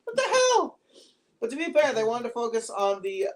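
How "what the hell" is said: much higher, baffled and scoffing; a high, laughing what-the-hell; incredulous disbelief. Retold or imitated. To the film, mock disbelief at a weak quip. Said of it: "I'm like".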